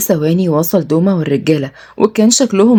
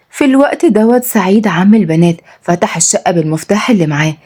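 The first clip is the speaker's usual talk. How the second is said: ordinary delivery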